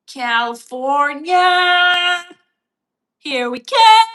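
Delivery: high, loud, drawn-out cheer; excited cheer. Pretend. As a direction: belt it out like a road-trip shout. Cheering for California.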